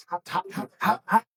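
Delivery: gasping through a hot bite; panting around scalding food; mouth full of hot food. Real, not acted. Bite of piping hot fritter.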